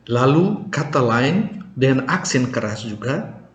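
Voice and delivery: normal talking voice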